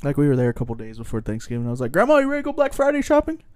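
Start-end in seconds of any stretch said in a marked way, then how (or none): 1.9-3.4 high, fast and eager; eager invitation. Retold or imitated. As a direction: pitch it up like a kid who can't wait. Inviting grandma to go shopping.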